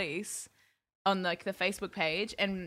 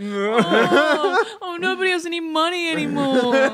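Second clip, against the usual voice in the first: louder, laughing mock news anchor; sarcastic newsreader; 5 semitones higher, 9 dB louder than usual. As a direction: whine the news through laughter. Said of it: "[name] as a sarcastic newsreader".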